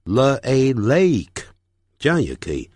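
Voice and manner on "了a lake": 了, A, then lake blended slowly; slow step-by-step lake; sounding out. Shown as a demonstration. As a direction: split lake into 了 plus A, then join. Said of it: "所以你念成了".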